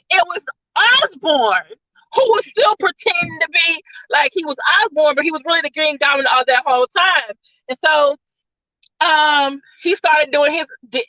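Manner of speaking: usual speaking voice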